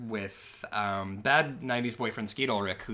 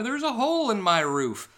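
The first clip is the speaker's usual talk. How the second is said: high, louder whine about the roof; mock whiny complaint; 9 semitones higher, 5 dB louder than usual. Girl complains about her new house, in a high whine. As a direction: squeak out the complaint. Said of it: "quoting the girl".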